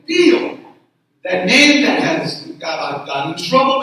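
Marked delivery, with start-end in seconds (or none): 0.1-0.6 higher-pitched mom's call across a store. Retold or imitated. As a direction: call out a nickname like mom. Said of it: "as soon as I hear my mom's name".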